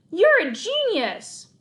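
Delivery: high, loud and slow exclamation; thrilled outburst; overjoyed. A narrator's reading. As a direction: praise him with delight. Otto thrilled by the plan.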